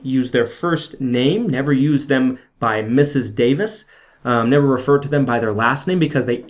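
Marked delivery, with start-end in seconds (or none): none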